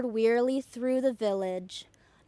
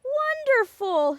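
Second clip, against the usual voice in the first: much higher, loud, drawn out; delighted shout; 12 semitones higher, 5 dB louder, slower than usual. A narrator's reading. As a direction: cheer it out slowly. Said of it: "shouted the travelers".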